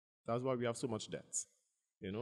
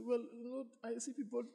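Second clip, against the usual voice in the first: mock worried whine; 11 semitones higher, 3 dB louder than usual. His own worried voice retold.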